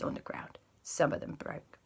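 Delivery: soft whispered sigh of a line; hushed regret. A narrator's reading. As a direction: whisper it like a small pity. Sad hushed narration.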